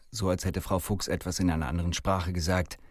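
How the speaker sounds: plain reading voice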